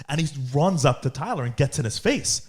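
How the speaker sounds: ordinary talk, nothing marked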